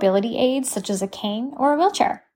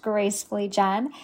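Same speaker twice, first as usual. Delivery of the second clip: usual speaking voice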